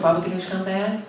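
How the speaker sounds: ordinary talk, nothing marked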